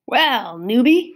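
loud mocking call across the camp; husky yell. A narrator's reading. Bully calling out to the new kid, loud and mocking. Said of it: "a husky voice yelled".

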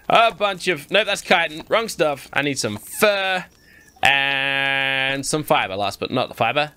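ordinary talk, nothing marked

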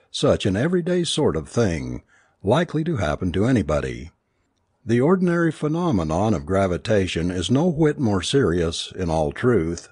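ordinary delivery, nothing marked

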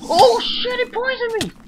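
very high, loud panicked yells; shrieking in alarm; panicked screaming. Real, not acted. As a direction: scream in real panic as it attacks. Snake attacks and poisons him.

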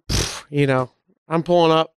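ordinary talk

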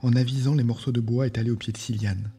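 ordinary delivery

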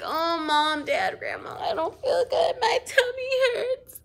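high, whiny complaints of pain; whiny faked illness. Child fakes sickness to parents, high whining complaints. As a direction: whine like a kid faking sick. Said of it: "If you're like".

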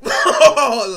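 a sudden, loud, high burst of laughter. Real, not acted. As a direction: burst out laughing hard at the memory. Laughing at quoted remark.

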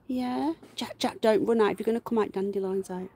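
ordinary talk, nothing marked

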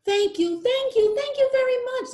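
very high, delighted little fish; overjoyed thanks. Acted. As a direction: gush thanks, squeaky with joy.

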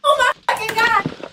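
loud, high, drawn-out wail; exasperated outburst. Real, not acted. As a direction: wail it out in sheer frustration. Frustrated over missing weed.

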